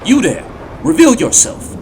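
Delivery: loud, high soldier's command; shouted challenge. Acted. Spartan confronts a suspected imposter, commanding shout.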